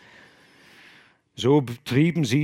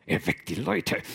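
usual speaking voice

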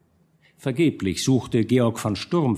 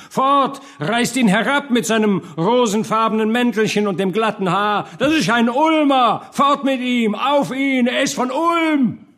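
high, raised mob yells; shouting down; 11 semitones higher, 4 dB louder than usual. A narrator's reading. Burghers turn on the man from Ulm, shouting him down. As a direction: yell to have the speaker dragged off. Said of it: "überschrie man ihn".

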